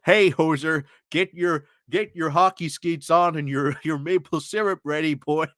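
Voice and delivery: hammed-up mock Canadian accent; terrible Canadian accent. British commentator mimicking Canada calling about a deal, bad Canadian accent. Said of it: "that's a terrible Canadian accent".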